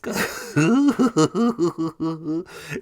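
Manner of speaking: high, loud imitated chuckle; Ron Swanson laugh. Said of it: "has a really unique laugh".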